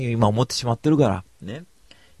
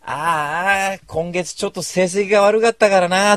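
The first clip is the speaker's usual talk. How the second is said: loud, higher mock sigh of a salesman; loud mock complaint; 9 semitones higher, 9 dB louder, slower than usual. To passing Amway members, loudly faking a bad sales month. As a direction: announce it for the passing crowd, loud and high. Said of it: "ってちょっと大きな声で言うんですよ".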